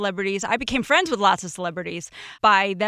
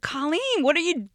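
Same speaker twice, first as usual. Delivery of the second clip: surprised celebrity; 7 semitones higher than usual. Retold or imitated. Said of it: "he's like".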